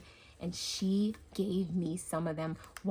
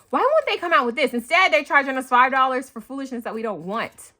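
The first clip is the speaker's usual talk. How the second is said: louder exasperated rant; mock rant; 4 semitones higher, 9 dB louder than usual. Real, not acted. Griping to viewers about stores, loud and exasperated. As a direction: complain with playful outrage.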